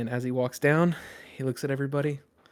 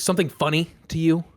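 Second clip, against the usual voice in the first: higher, louder challenge; confronting captain; 4 semitones higher, 6 dB louder, faster than usual. Acted. Captain walking up to a chuckling passenger, challenging. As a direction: call out the man who chuckled.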